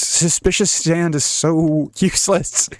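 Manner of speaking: usual speaking voice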